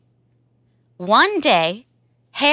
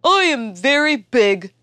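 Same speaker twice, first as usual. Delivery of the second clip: elephant voice; 3 semitones higher than usual. Acted. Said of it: "says Elephant".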